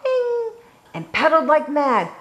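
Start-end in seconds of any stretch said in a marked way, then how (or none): 0.0-0.5 very high ringing ding. A narrator's reading. Froggy hops on his bike, high ding. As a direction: chime it out high.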